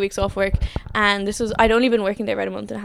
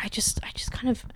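whisper; 9 dB quieter than usual. Real, not acted.

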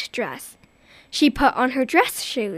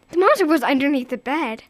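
plain reading voice

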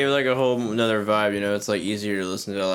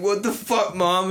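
put-on high, whiny kid voice; high mocking teen voice; 7 semitones higher, slower than usual. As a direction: play the outraged kid yelling at mom. Acting out the angry kid.

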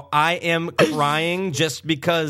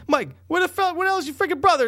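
impatient yelling; faster than usual. Retold or imitated. Dad yelling, waiting for brother.

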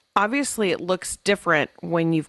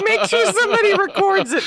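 excited; 5 dB louder than usual. Real, not acted. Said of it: "'cause this will be funny".

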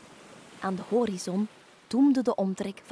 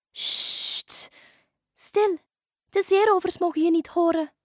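urgent shush, then high voice; hushing dolphin; 8 semitones higher, 5 dB louder than usual. Acted. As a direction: hush her as a watchful dolphin. Dolphin hushes the excited witch. (sussende, hoge dolfijnenstem)

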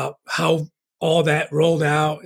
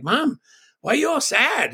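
put-on asking as a kid; 8 semitones higher than usual. Retold childhood talk with mom.

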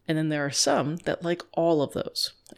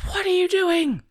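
much higher and louder, accusing; sudden outburst; 5 dB louder than usual. Pretend. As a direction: snap it out at the guest. Host suddenly scolds guest.

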